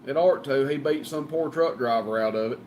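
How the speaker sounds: ordinary talk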